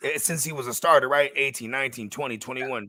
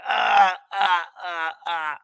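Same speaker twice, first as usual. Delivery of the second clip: mock mobster laugh; 3 semitones higher, slower than usual. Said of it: "He started laughing".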